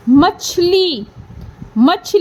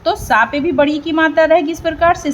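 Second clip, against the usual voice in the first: normal talking voice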